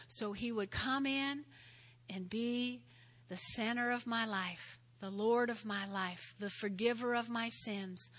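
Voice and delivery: soft, sincere prayer voice; sinner's prayer. Acted. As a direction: pray it gently for them. Models prayer.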